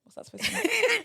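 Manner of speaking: high, laughing mock outrage; squeaky put-on indignation; mock offended. Real, not acted. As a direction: act offended through a laugh. Offended by bob remark.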